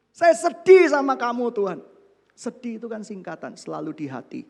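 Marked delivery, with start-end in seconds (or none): 0.1-1.8 loud, high mock complaint to God; mock whining. Preacher retelling his prayer to God, loud hammed-up whining.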